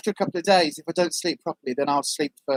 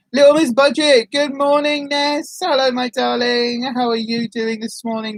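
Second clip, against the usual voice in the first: high, drawn-out hello easing downward; singsong greeting; 5 semitones higher than usual. Real, not acted. Host welcomes her darling viewer, singsong greeting. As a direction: call it out high, then settle.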